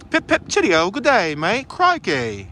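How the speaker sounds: hammed-up British and Aussie greeting; mock British-Australian accent. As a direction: put on a jolly Brit-Aussie accent.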